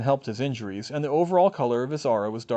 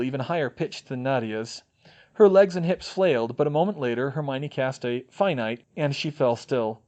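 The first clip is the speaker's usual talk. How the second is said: usual speaking voice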